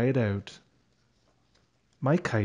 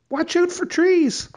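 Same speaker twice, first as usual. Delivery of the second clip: high, loud call of warning; warning shout; 6 dB louder than usual. A narrator's reading. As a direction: shout it out to stop the crash. Dad warns kite is near trees.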